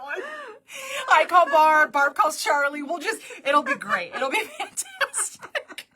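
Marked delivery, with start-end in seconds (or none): 1.1-5.9 laughing. Real, not acted. Joking about phone tree.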